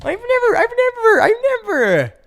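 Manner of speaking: squeaky high mock plea; high pleading voice. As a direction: whine it to granny in a high voice. Voicing himself pleading to grandma.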